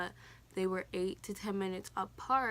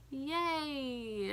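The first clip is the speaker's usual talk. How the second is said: higher sarcastic yay; mock cheer; 6 semitones higher than usual. Laboring mother answering the nurse's push call, sarcastic cheer. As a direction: fake a cheer you don't feel. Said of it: "I was like".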